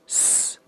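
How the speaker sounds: "s" as a long unvoiced hiss; isolated "s" sound, held; voiceless s. Shown as a demonstration. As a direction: demonstrate "s" with no voice at all. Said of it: "发音直接读成清音的s".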